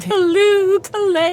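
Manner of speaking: high, sing-song mock exclamation; mock exultant cry. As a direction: toss out mock-joyful place names.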